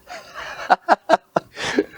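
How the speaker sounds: high, airy giggle; breathless high chuckle; laughing. Real, not acted. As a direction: burst of high laughter at own story.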